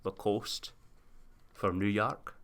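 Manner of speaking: put-on understated Sean Connery accent. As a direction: tell the plot in a quiet Scottish Connery accent. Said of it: "I have to stop doing the accent".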